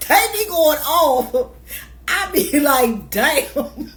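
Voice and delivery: words spilling out through laughter, high and loud; laughing while talking. Real, not acted. Telling friends about the office, laughing. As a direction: talk through your own laugh.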